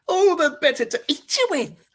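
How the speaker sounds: high, loud gleeful yip. Acted. Wolf triumphantly answering the brat. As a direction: yip it with delight before the pounce. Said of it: "I yipped".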